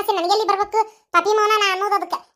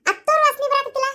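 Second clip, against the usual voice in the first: suspicious mother-in-law; 10 semitones higher than usual. Acted.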